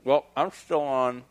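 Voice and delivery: talking around a cigar; cigar in mouth. Real, not acted. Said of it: "Why am I talking with a cigar in my mouth?".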